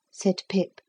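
usual speaking voice

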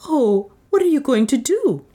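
high, alarmed question; alarmed exclamation. A narrator's reading. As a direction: exclaim in alarm, pitch leaping up. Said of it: "the latter exclaiming".

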